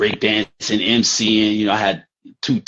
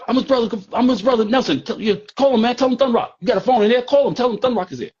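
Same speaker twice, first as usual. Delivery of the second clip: insistent shouting; faster than usual. Retold or imitated. Nelson shouting at V to phone his brother, insistent. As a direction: keep shouting orders at him.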